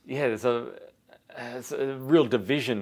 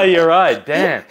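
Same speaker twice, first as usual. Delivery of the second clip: ordinary talk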